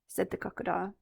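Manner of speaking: ordinary delivery, nothing marked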